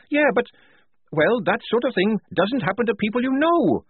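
high, strained objection; doubting protest. Acted. Tonka resisting what Polly says, protesting. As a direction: push back, pitch jumping high.

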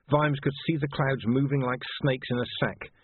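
plain reading voice

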